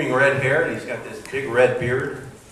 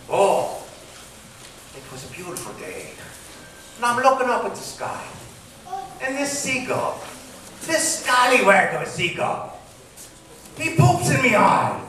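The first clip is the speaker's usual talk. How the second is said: pirate yarn; 9 semitones higher than usual. Acted. Pirate tells his eye patch story.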